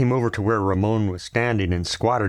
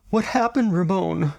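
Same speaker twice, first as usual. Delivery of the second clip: higher voice for the anxious mother; lightly voiced worried mother; 10 semitones higher than usual. A narrator's reading. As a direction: ask it as a fretful mother. Mother questions hurt son.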